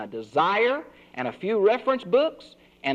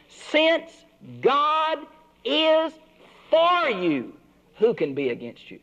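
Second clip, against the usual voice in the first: "Since God is for you" far higher, slow pauses, "is" lifted highest; certain since; 10 semitones higher, slower than usual. Shown as a demonstration. Preacher assuring the congregation God is for them, emphatic and high. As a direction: spell out the certainty word by word, high and deliberate. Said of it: "That is a first-class conditional".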